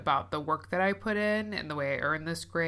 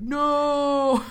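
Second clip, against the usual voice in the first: high, loud mock refusal; body saying no; 10 semitones higher, 7 dB louder than usual. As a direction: voice her body refusing flatly. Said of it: "my body was like".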